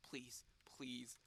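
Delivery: whispered begging; whispered pleading. Real, not acted. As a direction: plead under your breath. Begging under breath during descent.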